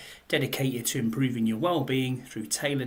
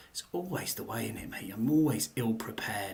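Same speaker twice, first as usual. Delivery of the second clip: whispered aside; 3 semitones lower, 7 dB quieter than usual. Real, not acted. Muttered aside while finding read.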